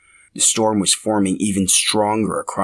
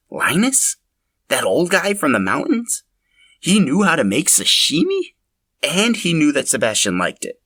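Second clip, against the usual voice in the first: high, incredulous questions; stunned inner voice piling up questions; astonished disbelief; 8 semitones higher than usual. Acted. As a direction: sound like you can't believe it. Farmer's astonished inner thoughts.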